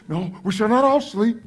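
normal talking voice

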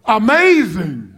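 a drawn-out, awed "Amazing.". Retold or imitated. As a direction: savor "Amazing." like a preacher's favorite word. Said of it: "one word that seems like it's in every sermon".

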